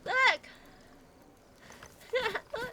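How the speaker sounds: sudden bright shout; excited call. Real, not acted. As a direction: grab their attention. Excited find in game.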